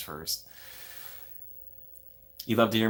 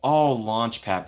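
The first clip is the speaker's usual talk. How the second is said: mock gushing fan; 5 semitones higher, slower than usual. Gushing fan over character.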